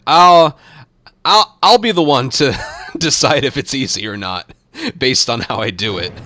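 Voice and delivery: loud, high, playfully offended; mock indignant. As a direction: push back in mock outrage. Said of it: "indignant mock protest at "super easy".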